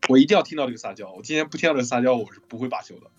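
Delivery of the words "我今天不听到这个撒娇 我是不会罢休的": normal talking voice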